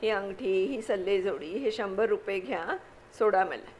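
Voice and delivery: ordinary delivery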